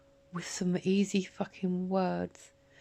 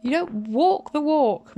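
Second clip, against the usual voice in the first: screaming fury; 6 semitones higher, 7 dB louder than usual. Real, not acted. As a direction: scream it at him, word by word. Rant peaks at Martin.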